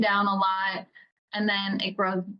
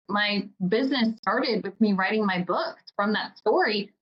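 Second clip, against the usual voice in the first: ordinary talk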